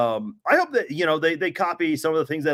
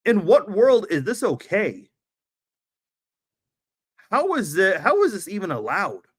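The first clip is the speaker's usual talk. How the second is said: exasperated rant; 4 semitones higher than usual. Real, not acted. Ranting at the NFL.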